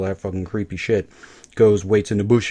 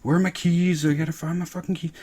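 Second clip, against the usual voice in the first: higher put-on frantic search; mock groggy Kieran; 8 semitones higher than usual. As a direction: scramble for keys in a hammed-up voice. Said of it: "he's like".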